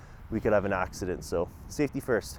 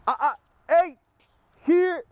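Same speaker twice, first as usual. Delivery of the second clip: sharp, high, louder warning at the dog; upset high tones; 14 semitones higher, 6 dB louder, slower than usual. Real, not acted. Trainer stopping his dog biting the leash, upset high warning. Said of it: "heard me get upset and do some high tones".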